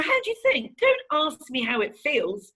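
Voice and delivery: imitating her own cross comeback; retold angry retort. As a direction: throw the question back, mock-indignant. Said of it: "I was so angry".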